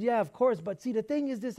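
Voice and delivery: a hint of a manager starting to argue; imitating the manager. Manager answering the apology, lightly voiced and pushy. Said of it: "And he said".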